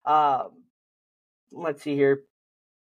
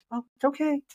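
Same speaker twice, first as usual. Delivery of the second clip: put-on higher, soothing voice for a frightened child; dad reassuring a lost child, higher and soothing; calm reassurance; 8 semitones higher than usual. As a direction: comfort a panicked kid, pitched up. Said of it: "Just be very calm".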